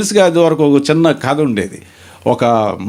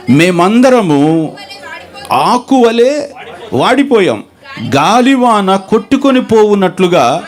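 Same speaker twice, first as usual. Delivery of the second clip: solemn scripture reading; 4 semitones higher, slower than usual. Acted. Preacher reading Isaiah to the congregation, slow and solemn. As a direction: lean into every word like a pulpit lament.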